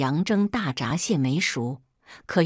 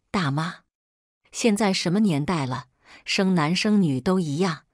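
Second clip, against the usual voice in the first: ordinary delivery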